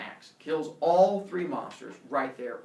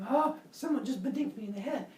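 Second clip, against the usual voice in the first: high-pitched startled gasp and exclamation; 11 semitones higher than usual. Retold or imitated. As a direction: act out a surprised victim, pitched up. Startled Heracles hit from behind.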